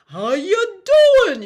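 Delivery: high, loud call of How you doing; playful call. Shown as a demonstration. Teacher showing a fun calling exercise. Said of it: "just have a lot of fun with it".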